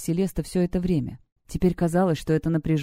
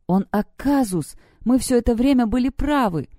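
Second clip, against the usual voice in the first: horrified revelation; 6 semitones higher, 3 dB louder than usual. A narrator's reading. Celeste tells her friends they were right all along, horrified. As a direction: announce the dreadful truth, voice raised in pitch. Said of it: "Она с ужасом посмотрела на нас".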